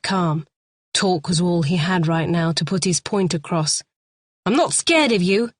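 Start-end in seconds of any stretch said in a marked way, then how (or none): 4.5-5.5 taunting. A narrator's reading. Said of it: "he taunted".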